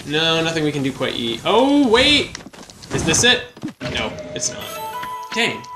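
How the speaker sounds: ordinary talk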